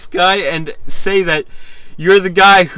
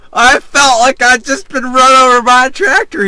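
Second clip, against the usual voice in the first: higher and louder, still laughing; laughing reread; 8 semitones higher, 7 dB louder than usual. Real, not acted. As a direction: read it again barely holding the laugh.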